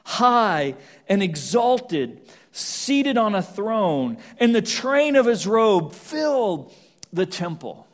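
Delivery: slow grand proclamation. Acted. Preacher describing the Lord on his throne, slow and proclaiming. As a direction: paint the throne room with slow, swelling awe.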